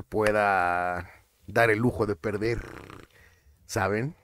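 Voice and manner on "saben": ordinary talk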